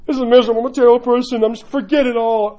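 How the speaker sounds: loud, high-pitched mock self-pity. Pity-party goer condemning themselves, mock despair. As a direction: wallow out loud, hammed up. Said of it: "when you go to the pity party".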